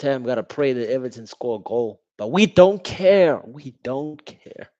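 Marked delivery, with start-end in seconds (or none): 2.2-3.4 slow, loud, high gloat; gloating taunt. Real, not acted. Fan taunting rivals, a loud slow gloat. 3.5-4.7 slow, soft, dismissive; mock throwaway. Real, not acted. Fan mocking rivals, a soft slow shrug.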